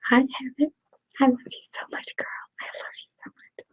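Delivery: ordinary talk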